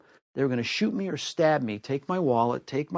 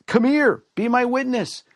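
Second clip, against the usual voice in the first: calling out; 10 semitones higher, 7 dB louder than usual. Retold or imitated. Calling out to a witness.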